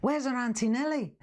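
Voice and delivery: high-pitched imitated Liverpool question; Scouse friend. Confused friend asking customer mid-demo, high Scouse. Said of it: "And she says".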